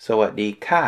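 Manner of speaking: สวัสดีค่ะ ending ค่ะ on a short falling tone; teacher modelling the falling ค่ะ. Shown as a demonstration. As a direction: drop the pitch sharply on ค่ะ. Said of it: "with a falling tone".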